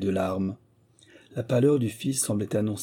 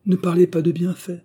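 higher and louder, NE pushed hard; heated protest, a touch louder; urgent protest; 9 semitones higher, 7 dB louder than usual. A narrator's reading. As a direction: cut her off with rising urgency. Louis protests, interrupting again.

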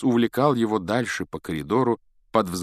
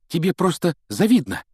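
put-on high, louder boyish retort; blurted out; 10 semitones higher, 5 dB louder than usual. A narrator's reading. Colin jumps to defend his idol against Malfoy, blurting. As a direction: blurt it out indignantly. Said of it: "выпалил Колин".

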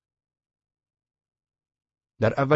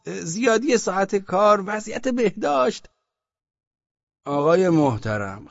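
high, loud official voice, hurriedly explaining; a bureaucrat covering his tracks; flustered official; 8 semitones higher, 4 dB louder than usual. Acted. Osman Bey backpedals to the merchants in a high, flustered official voice.

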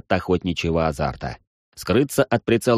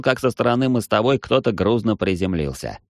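plain reading voice

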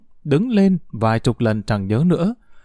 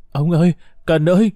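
mildly hoarse, higher-pitched cry out to family; hoarse calling; 4 semitones higher, 3 dB louder than usual. A narrator's reading. Thảo calls out to her father-in-law and child, hoarse calling. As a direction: call out higher, a touch hoarse. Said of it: "tiếng gọi khàn đặc của Thảo".

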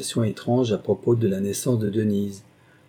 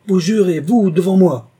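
loud, high scornful outburst; crushing contempt; 9 semitones higher, 9 dB louder than usual. A narrator's reading. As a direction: hurl it at him in outraged disdain. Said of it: "lancés avec un mépris si écrasant". (cri de mépris écrasant)

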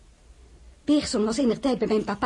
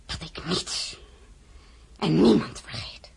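gefluisterd dreigement; 3 semitones lower, slower than usual. Acted. Dreigende woorden tegen de koningin.

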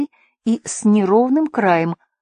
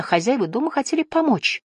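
usual speaking voice

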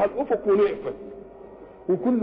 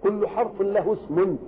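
usual speaking voice

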